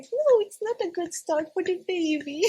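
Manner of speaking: high mock-scolding midwife voice; imitating the midwife. Midwife warning the stubborn mother in labour, mock-scolding.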